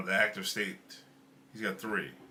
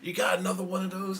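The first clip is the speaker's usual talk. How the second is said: higher-pitched, lightly imitated Southern accent; 5 semitones higher than usual. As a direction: mimic her Southern accent. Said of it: "In her Southern accent".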